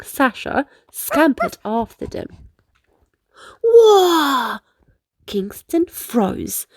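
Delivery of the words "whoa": high, loud gasp of wonder; startled delighted whoa; surprised whoa. Acted. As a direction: stop dead in amazement. Kingston froze at dolphins.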